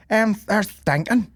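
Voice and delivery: put-on high, slow little-kid voice; wee girl. Retelling a kid at the goat pen, high wee-girl voice. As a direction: imitate a small child complaining. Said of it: "like a wee girl".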